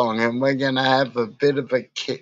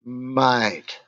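usual speaking voice

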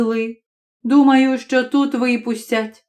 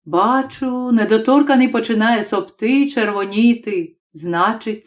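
plain reading voice

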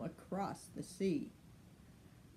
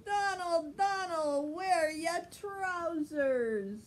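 calling out; 9 semitones higher than usual. Acted. Crowd calling to Donald.

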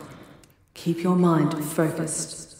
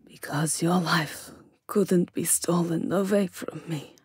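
soft, broken whisper; grieving whisper; 6 dB quieter than usual. Acted. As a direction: whisper it through grief. Grieving over fallen betrayer.

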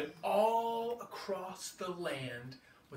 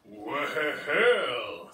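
loud, gruff T-Rex voice; roaring T-Rex; 3 semitones lower, 7 dB louder than usual. Acted. T-Rex challenging the little triceratops, loud monster voice. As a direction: boom it like a big dinosaur. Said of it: "said the T-Rex".

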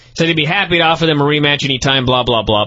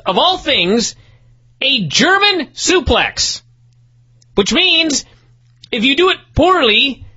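very high-pitched exasperated explanation; incredulous rant; 10 semitones higher than usual. Real, not acted. Host explaining the German suplex into tacks, incredulous. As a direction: spell out the absurdity in a high, disbelieving voice.

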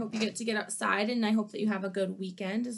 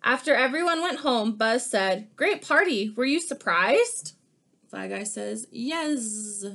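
lively picture-book read-aloud; animated storybook reading; reading to children; 4 semitones higher, 5 dB louder than usual. Acted. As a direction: read it like story time for kids.

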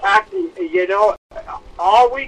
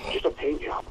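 softer, savoring repeat of paint job; quiet admiring echo; 4 semitones lower, 9 dB quieter than usual. Real, not acted. Host echoes his own praise of the fight, softly. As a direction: murmur it again, to himself, impressed. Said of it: "Just a paint job with that jab".

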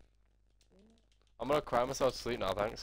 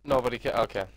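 normal talking voice

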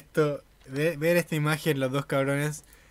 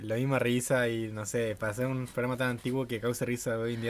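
ordinary talk

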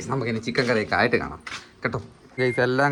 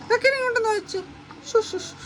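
much higher, squeaky coaxing voice; 5 dB quieter than usual. Real, not acted. Owner calling her pet, high squeaky baby voice. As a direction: call the pet in a tiny high voice.

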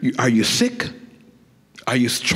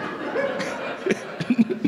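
high breathy giggle; 14 semitones higher, 6 dB quieter than usual. Retold or imitated. Mock stoner laugh after impression.

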